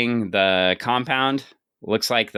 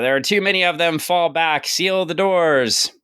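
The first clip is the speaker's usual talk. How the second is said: higher, louder soldiers' shout to retreat; retold yelling; 8 semitones higher, 3 dB louder than usual. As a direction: bark the retreat order, slowing on the last words. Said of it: "the private army's yelling".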